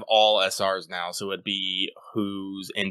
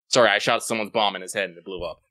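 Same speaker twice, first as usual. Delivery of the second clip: ordinary delivery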